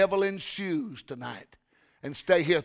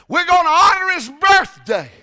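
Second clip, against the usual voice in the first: high, loud, slowed proclamation; excited announcement; 11 semitones higher, 11 dB louder than usual. Retold or imitated. Speaker announces pastor's birthday party to church, loudly excited. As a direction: announce it like a grand celebration.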